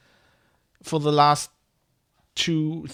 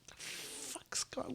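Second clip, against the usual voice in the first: whispered curse; 20 dB quieter than usual. Real, not acted. Muttered curse.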